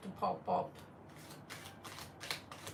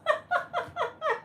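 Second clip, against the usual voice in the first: high, bursting real laugh; helpless laughter. Real, not acted. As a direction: crack up in a rapid cackle. Cracking up at viewer's question.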